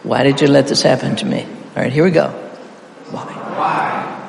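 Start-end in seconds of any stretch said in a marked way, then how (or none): 0.0-1.4 all the words run together without a pause; quick, even practice read; fast run-through. Shown as a demonstration. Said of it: "we'll practice all the way through fast". 3.1-4.2 "Why" alone, drawn out; "Why" held long as the emphasized first word; stress on WHY. Shown as a demonstration. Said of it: "emphasize the first word the first time".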